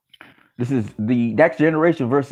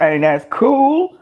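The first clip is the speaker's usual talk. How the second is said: normal talking voice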